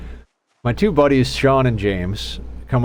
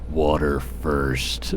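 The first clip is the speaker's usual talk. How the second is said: much lower, very slow, soft gruff croak; gruffest hungover voice; 8 semitones lower, 5 dB quieter, much slower than usual. Retold or imitated. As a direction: groan it out like the worst hangover ever. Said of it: "in the gruffest, most hungover voice".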